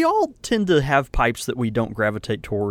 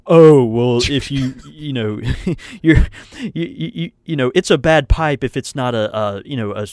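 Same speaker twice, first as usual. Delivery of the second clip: snobby pipe expert; 2 semitones higher, 6 dB louder than usual. Retold or imitated. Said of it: "guys that are gonna say".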